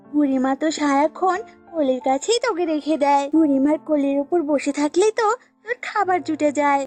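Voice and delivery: lightly voiced goat, resentful. Goat blames snake for lost care, mildly cross. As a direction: pick a quarrel, mildly cross.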